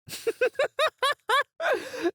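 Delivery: villain laugh. Acted. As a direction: laugh in delight at their silence. Villain delighted by defiance.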